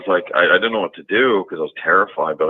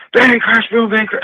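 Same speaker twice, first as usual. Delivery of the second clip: loud, high mock crashing sounds; crash noises; 8 dB louder than usual. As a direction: shout out the bangs like a crash. Mimicking loud forest crashing.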